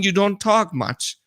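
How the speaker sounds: ordinary talk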